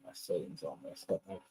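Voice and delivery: faint, distant mic pickup; talking well away from the microphone; far from mic. Real, not acted. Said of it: "it sounds like you're far away".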